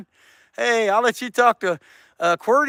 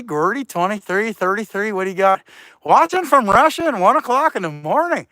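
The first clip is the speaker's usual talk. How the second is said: normal talking voice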